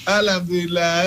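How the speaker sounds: "Alhamdulillah" said quickly with a sob in the voice; a mock-crying, quivering "Alhamdulillah"; tearful Alhamdulillah. Shown as a demonstration. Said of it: "berbeza intonasi dia". ("Alhamdulillah" dengan suara tersedu-sedan)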